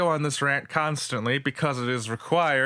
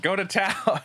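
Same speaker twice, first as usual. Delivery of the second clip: "Go to town" with a laugh, pitched up; 6 semitones higher than usual. Real, not acted. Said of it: "wrong word".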